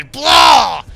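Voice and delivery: very loud "blah" puke noise; explosive, high cartoon retch; imitated vomiting. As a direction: mimic a violent throw-up with a shouted blurt. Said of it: "I basically puked all over the kid".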